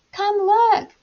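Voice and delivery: high calling voice. A narrator's reading. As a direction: call the others over. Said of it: "I called".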